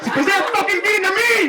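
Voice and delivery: high, loud mock-furious retort; exasperated yell. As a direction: yell it as an outraged relative. Sister-in-law's acted angry retort.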